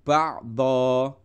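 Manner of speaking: ba'do with a long final o, no n; teacher showing the pause form; pausal form. Shown as a demonstration. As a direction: stop on ba'do, drawing out the o. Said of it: "kalau berhenti nanti bunyinya adalah".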